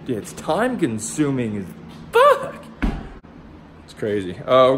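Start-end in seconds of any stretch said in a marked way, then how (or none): 2.1-2.6 sudden pitch jump on the swear; squeaky high swear. Real, not acted. Vlogger curses at the sanding, voice jumping up. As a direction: let the curse fly up high.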